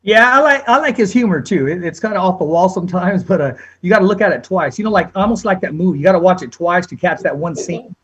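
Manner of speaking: ordinary delivery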